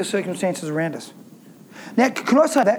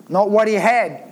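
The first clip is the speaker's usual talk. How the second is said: ordinary talk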